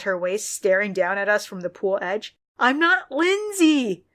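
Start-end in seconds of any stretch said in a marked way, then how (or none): 2.6-4.0 high, slow read scream; a high, drawn-out shriek, understated; mild read scream. A narrator's reading. Narrator reads Lindsay screaming by the pool, high and slow. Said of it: "Lindsay screamed again".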